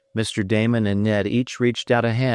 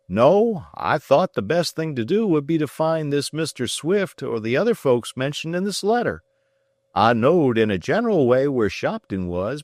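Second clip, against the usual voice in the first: lightly voiced rustic farmer, high; a plain country man vouching for his boy; 7 semitones higher than usual. A narrator's reading. Farmer backs up his son's story to Ned.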